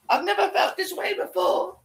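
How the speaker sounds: high, slow hammed-up swooning wail; mock lovestruck woman. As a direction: ham up a swooning lovesick confession. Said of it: "makes her feel all funky inside".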